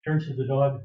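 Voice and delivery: ordinary talk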